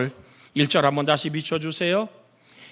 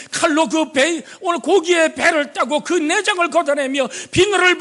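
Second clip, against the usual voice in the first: preaching; 11 semitones higher than usual. Acted. Preacher building the scene.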